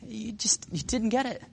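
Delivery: high, soft, halting reproach; lightly acted, softened disappointment; gentle exasperation. Jesus to Peter, gently exasperated.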